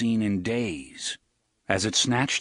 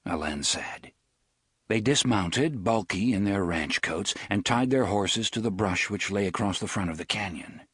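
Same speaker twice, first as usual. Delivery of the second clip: normal talking voice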